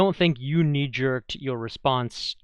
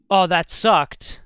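slow, louder, higher mock gripe; mock complaint; 6 semitones higher, 7 dB louder, slower than usual. Player griping to the GM, a slow mock whine. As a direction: drag out a whiny player's complaint.

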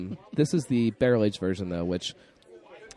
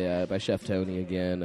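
ordinary talk, nothing marked

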